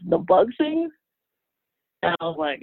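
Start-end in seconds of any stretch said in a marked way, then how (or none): 0.1-0.9 higher, baffled echo of a question; retold puzzled reaction. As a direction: ask it baffled, pitch climbing high. Puzzled reply to the doctor's question.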